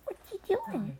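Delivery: cooing to a kitten. Real, not acted. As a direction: coo at the kitten.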